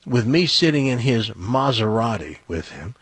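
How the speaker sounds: ordinary talk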